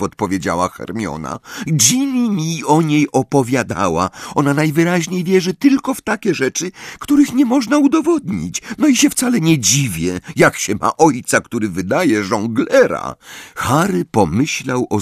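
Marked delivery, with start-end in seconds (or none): 1.7-13.1 put-on high, raised girl's voice; disdainful Hermione. A narrator's reading. Hermione gossiping to Harry about Luna, disdainful. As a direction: rattle it off in high-pitched disdain.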